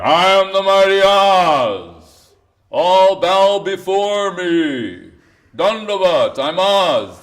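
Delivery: booming, slow mighty Oz voice; dark lord. Retold or imitated. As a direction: proclaim like an all-powerful wizard. Impersonator acting as mighty Oz.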